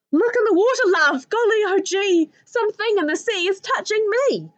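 mother screeching as she flees the water; Ma's screech. Acted. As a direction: screech it all in a panic. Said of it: "I heard a great screech".

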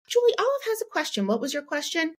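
very high, fast and sunny; bright prompting mom. Retold or imitated. Mom introducing Olive's question to server Julie, high and sunny. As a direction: chirp it up to put the child at ease.

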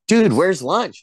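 mock outrage of a hungry actor; indignant complaint. Retelling his hungry complaint on set.

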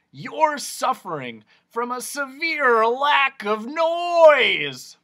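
slow, high, loud diagnosis; eccentric noise doctor. Acted. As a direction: pronounce it grandly, swelling to a shout on the last word.

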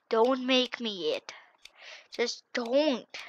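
ordinary delivery

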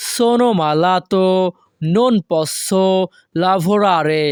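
very slow, careful Italian model reading; teacher pacing it out; slow model sentence. Shown as a demonstration. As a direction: draw each word out for learners. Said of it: "এর Italian ভাষা হবে".

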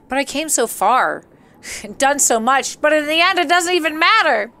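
overwrought, rising mock despair; mock melodrama. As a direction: build into a high, hammed-up lament. Breaks into mock dramatic lyric.

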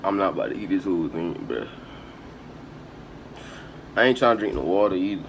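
normal talking voice

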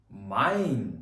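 Mein said as main, ei like ai; German ei as ai. Shown as a demonstration. Teacher demonstrates German word to class: Mein with ai. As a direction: model Mein with a clear ai. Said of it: "Читається як ай".

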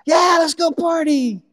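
high, loud, slow and stretched out; excited party call. Acted. Son who should be glad, calling to join the party. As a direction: cheer it like the best news ever. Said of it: "the response should be".

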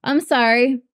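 fighting back tears after the song; getting emotional. Real, not acted. Viewer overcome by the song's ending, voice breaking with emotion. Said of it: "I was not expecting to get emotional".

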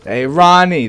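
loud, slow party-bro call; mock Jersey Shore guido yelling. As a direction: holler it like a Jersey Shore partier. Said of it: "they'd always be like".

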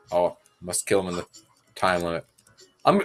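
usual speaking voice

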